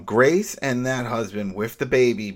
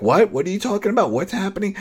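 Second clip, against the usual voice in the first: high alarmed questions; alarmed cry; 9 semitones higher, 5 dB louder, faster than usual. Retold or imitated. Grace hearing the husband over the walkie, high alarmed cry. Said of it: "And she's like".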